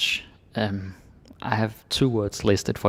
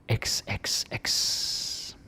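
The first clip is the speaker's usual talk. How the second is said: whisper; 5 dB quieter, much slower than usual. Shown as a demonstration. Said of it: "teacher whispers the letter".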